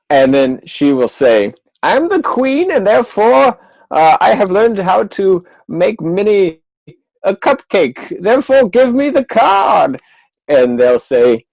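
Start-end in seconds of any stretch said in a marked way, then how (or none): 1.8-10.0 high pompous queen, ending in a shout; a haughty queen who grows demanding. Acted. Magician voicing the queen demanding the card.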